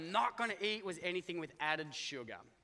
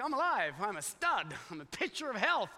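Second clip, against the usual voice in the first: elated boasting; 2 semitones higher, 3 dB louder than usual. Retold or imitated. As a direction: crow over the good news, playfully. Boasts after good scan result.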